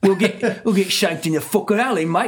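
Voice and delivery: high, put-on British tough-guy accent; jokey British accent about getting shanked; mock British accent. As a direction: ham up a British accent while laughing. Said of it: "my U K accent".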